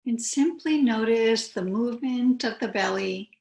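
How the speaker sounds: unhurried yoga teacher, long pauses between phrases; calm, spacious meditation voice; guided meditation. Real, not acted. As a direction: guide them gently into stillness, phrase by phrase.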